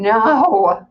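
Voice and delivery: imagined scream. A narrator's reading. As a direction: wail it out in hammed-up despair. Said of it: "falling to my knees and screaming".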